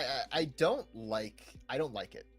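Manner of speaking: normal talking voice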